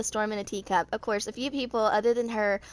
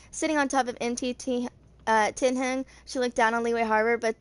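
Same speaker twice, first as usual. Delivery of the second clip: ordinary delivery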